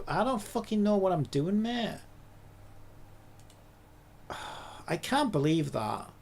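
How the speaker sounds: ordinary delivery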